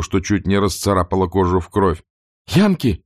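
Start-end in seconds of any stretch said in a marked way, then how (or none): 2.5-3.0 sharp high cry of alarm; frightened cry. Acted. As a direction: yelp out the word in panic. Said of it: "вскричала Скарлетт". (испуганный вскрик)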